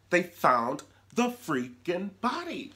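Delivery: high, loud and slow in disbelief; stunned, stretch it out; shocked. Real, not acted. Shocked about the body.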